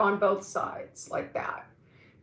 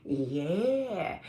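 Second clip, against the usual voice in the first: effort grunt; 4 semitones lower, 5 dB quieter than usual. Shown as a demonstration. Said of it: "you gotta be like".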